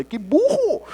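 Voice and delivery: high-pitched comic exasperation; mock self-reproach; retold self-scolding. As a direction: exclaim at your own stupidity, playfully. Scolds himself in the story.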